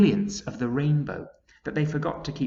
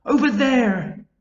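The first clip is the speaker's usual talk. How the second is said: much higher, loud excited calls; ladybird shouting; 11 dB louder, slower than usual. A narrator's reading. Ladybird to the travellers, high loud excited calls. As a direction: call out, pointing in wonder. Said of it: "shouted the ladybird".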